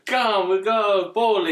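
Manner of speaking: high, loud, slow Eastern European shout; hammy cousin calling out to bowl; Roman Bellic. Acted. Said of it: "Roman Bellic from [name]".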